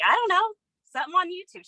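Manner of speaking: put-on high, light teen reply; daughter's voice. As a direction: lift into the daughter's breezy voice.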